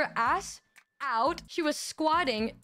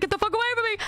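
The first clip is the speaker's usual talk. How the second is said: mock scream; 8 semitones higher, much faster than usual. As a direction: yell it as if fleeing in panic. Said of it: "And I started screaming".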